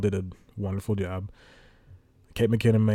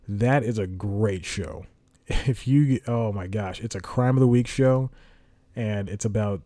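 usual speaking voice

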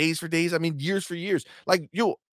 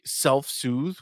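ordinary delivery

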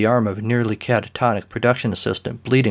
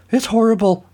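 high, loud distraught actress; crying actress; 12 semitones higher, 3 dB louder than usual. Acted. Distraught actress cries to the detectives, high and loud. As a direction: wail it like a stricken starlet. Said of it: "she cried".

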